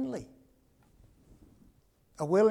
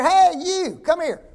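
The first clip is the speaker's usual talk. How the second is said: loud, higher shout across the yard; calling out; 7 semitones higher, 8 dB louder, slower than usual. Retold or imitated. Preacher calling a little kid over, loud. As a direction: call the kid over from a distance.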